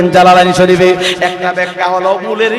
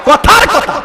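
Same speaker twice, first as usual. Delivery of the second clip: preaching at full force; 12 semitones higher, slower than usual. Acted. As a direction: shout it to the crowd.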